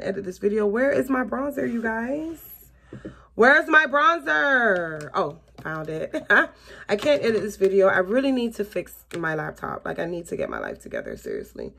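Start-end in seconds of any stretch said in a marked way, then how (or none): none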